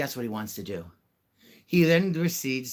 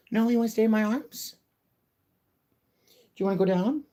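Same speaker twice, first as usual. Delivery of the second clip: high pet talk; 9 semitones higher, 5 dB louder than usual. Real, not acted. Owner coaxing her cat to go down in high baby talk. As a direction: coo each word up at the cat.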